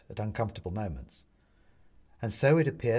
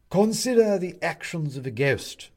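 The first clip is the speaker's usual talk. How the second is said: high, loud lecturing; pompous owl judge; 7 semitones higher, 6 dB louder than usual. Acted. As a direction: open a grand disquisition. Owl begins disquisition.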